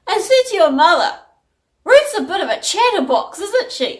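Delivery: high, loud imitation of a chatty dad; dad impression. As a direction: play Dad passing on gossip, pitched right up. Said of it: "Do you know what Dad said to me".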